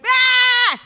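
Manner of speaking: family shrieking over the ad translation; mocking family. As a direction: howl with laughter at her. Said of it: "they were like".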